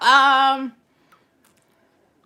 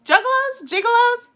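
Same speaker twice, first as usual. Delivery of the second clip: both words asked much higher and louder; puzzled high questioning of the two words; high confused asking; 12 semitones higher, 6 dB louder than usual. Shown as a demonstration. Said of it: "Are they gigolos or juggalos".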